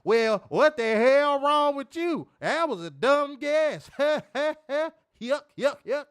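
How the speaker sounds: high, loud, drawn-out hammed-up country caricature; mocking exaggerated comedian's outburst; Steve Harvey. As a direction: stretch the words and add a goofy laugh. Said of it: "caricature of blackness bullshit".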